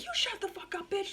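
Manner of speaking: high, sassy mock yell; defiant comeback. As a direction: fire it back at the nurse. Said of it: "and I was like".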